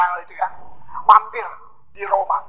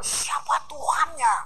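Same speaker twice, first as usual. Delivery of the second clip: hushed question; 3 semitones higher, 7 dB quieter, slower than usual. Retold or imitated. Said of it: "Mereka bertanya".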